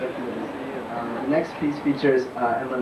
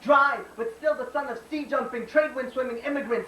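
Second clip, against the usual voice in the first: spoken-word poem; 11 semitones higher than usual. Acted. Poet tells America of a mother's hands, declaimed spoken-word poem. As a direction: slam poet's raised-pitch declaiming.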